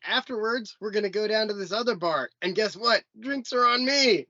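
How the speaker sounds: hyped generous bro; imitating hyped buddy. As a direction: shout it like a generous friend. Buddy voiced in story.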